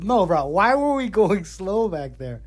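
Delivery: a touch of laughter through the question; lightly laughing repeat. Retold or imitated. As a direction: ask it again while chuckling. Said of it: "He starts laughing".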